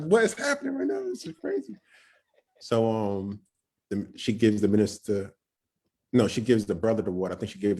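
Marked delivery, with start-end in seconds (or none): 0.0-1.8 squeaky very high bewildered voice; disbelief. Retold or imitated. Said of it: "I was like".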